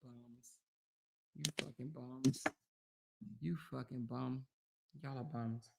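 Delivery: very low, very soft grumble; muttered insult. Real, not acted. Streamer dismissing rivals during water break, muttering. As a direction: mutter it under your breath.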